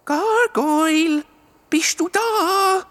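Gargoyle drawn out slowly, high, voiced and calling. A narrator's reading. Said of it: "Das Mal ein bisschen lauter".

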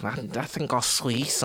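imitated Scouse rapper; Scouse accent. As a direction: quote it in a light Scouse accent. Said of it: "I don't normally like the Scouse accent".

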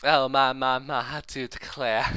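mock-fancy Southern belle accent. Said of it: "She just wants to sound fancy".

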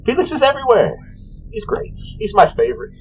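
high-pitched, sing-song character voice; high, airy shopkeeper; cheery potion seller. Acted. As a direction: pitch it up like an obliging little merchant. Voices Jenny about Felix.

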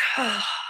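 long, soft sighing exhale; demonstrated sigh. Shown as a demonstration. Coach leading listeners in a breath, long audible sigh. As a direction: let the breath out through the mouth. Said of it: "let it out through a sigh".

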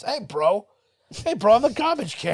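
cheeky bro voice from a trash can; high, loud bro voice for a droid; Gronk voice. Pretend. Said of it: "I give him Gronk's voice".

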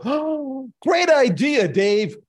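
high, sing-song fawning praise; sing-song gushing executives praising the CTO's idea; mock gushing executives. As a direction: ham up the yes-men cheering Dave. Executives praising the CTO, mocked.

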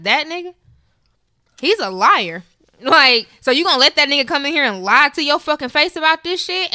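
mock outrage, speeding up at the end; imitated indignant friend. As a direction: quote a friend's outraged challenge. Acts out her friend's outrage.

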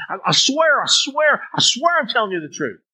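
high, repeated pleading oath; insistent swearing. Acted. Doubted person insisting they tell the truth, pleading high. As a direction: pile up the oaths, pitched high. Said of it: "having to add all these superlatives".

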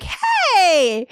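high, loud chirpy hey; imitating her own bubbly therapist greeting. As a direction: greet brightly like entering a room. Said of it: "there's multiple times where I'm like".

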